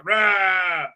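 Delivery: drawn-out "raaah", played for ridicule; mock Hulk roar. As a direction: do a mocking Hulk growl-yell. Mocking imitation of a Hulk.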